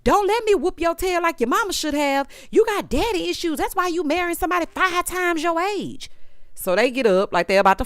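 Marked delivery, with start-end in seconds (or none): 0.0-6.1 imitating hollering. Imitating Heavenly yelling at Sweet Tea, a high mock holler. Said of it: "Heavenly got to hollering".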